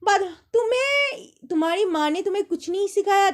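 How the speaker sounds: mother-in-law scolding. Retold or imitated. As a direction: imitate the mother-in-law nagging her. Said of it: "मेरी जो सास थी". (सास की नकल में ऊँची, तीखी डाँट)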